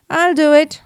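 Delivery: loud, eager I'll do it; eager agreement. Acted. Mouse answering the captain, eagerly. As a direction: jump at the offer.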